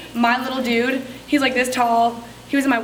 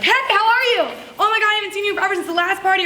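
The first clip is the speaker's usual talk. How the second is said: excited greeting; 6 semitones higher, faster than usual. Acted. Consultant greeting a guest at her party, excited. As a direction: greet her like a long-lost friend, pitched up.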